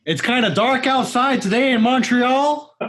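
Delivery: high-pitched director's weather report impression; David Lynch. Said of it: "I love his weather reports".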